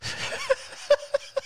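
unvoiced wheezing laugh; breathy high laugh. Real, not acted. Laughing at seagull story.

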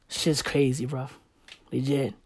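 low, soft conspiratorial whisper; hushed. Real, not acted. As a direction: share a secret theory quietly.

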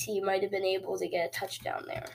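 ordinary talk, nothing marked

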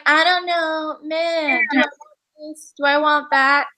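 high, dithering whine; whiny indecisive voice. Retold or imitated. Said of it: "She's like".

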